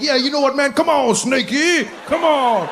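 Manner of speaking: high, hammed-up tough-guy taunt; mock bravado. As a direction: goad it with put-on macho bluster. Mock macho challenge to a snake.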